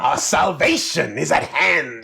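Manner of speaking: slow, high, drawn-out revival cry; preacher. Acted. Host showing the correct voice for the game line, as a preacher. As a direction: proclaim it like a preacher, stretching the words. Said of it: "do that in the correct voice".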